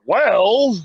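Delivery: a drawn-out, knowing "Well."; mock Mean Gene. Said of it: "Mean Gene's like".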